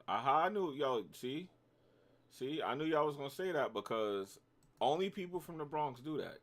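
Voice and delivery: buzzing i-told-you-so; excited gloating. Real, not acted. Catching viewers guessing his borough.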